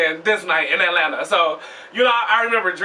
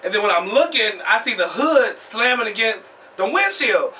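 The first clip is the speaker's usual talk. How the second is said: ordinary delivery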